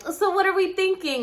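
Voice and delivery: high-pitched self-mocking imitation; mock dum-dum. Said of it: "I'm over here like a dum-dum".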